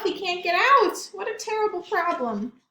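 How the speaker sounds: ordinary delivery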